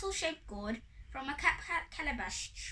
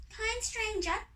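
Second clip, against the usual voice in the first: high, sweetly asking fairy; high-pitched courteous fairy; polite fairy request; 8 semitones higher than usual. A narrator's reading. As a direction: ask nicely in a high little voice. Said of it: "So the first fairy asked".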